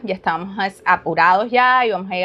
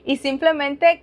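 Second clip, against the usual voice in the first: ordinary talk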